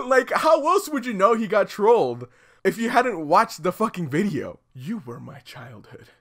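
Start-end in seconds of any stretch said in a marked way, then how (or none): none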